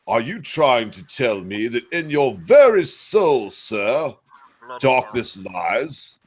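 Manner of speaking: slow, theatrical attempt at a British accent; an American trying a grand British accent, slowly. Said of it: "I would like to practice my British accent in one second".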